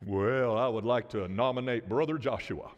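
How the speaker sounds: low, measured meeting voice; acted low-voiced committee member making a motion; formal nominator. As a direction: drop low and propose it solemnly. Voicing a member nominating Joshua.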